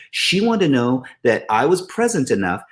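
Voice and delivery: normal talking voice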